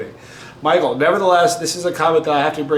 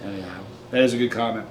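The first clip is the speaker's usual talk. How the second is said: ordinary talk, nothing marked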